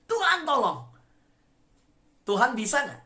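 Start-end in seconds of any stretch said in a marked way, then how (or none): 0.1-0.9 high-pitched cry for help; urgent acted yell from a sinking man; Peter crying out. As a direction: shout to the Lord while going under. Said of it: "dia teriak".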